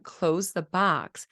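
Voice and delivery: "Close the box" slow, each word separate; words kept apart. Shown as a demonstration. Therapist showing the clear form of "Close the box". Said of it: "Instead of saying".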